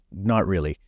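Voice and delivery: ordinary talk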